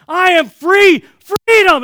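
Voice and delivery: high, very loud shout of release; jubilant yelling. Retold or imitated. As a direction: holler it out to the whole street. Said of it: "I just keep yelling this freedom".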